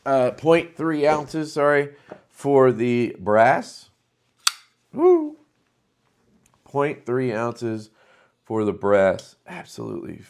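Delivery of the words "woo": high delighted woo; thrilled by the knife, a high woo; excited whoop. Real, not acted. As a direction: let out a high happy whoop.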